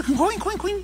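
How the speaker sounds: high squeaky oinking noise; playful mock pig grunt; piglet oink. Imitating the piglet's snout.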